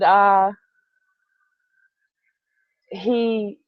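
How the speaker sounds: usual speaking voice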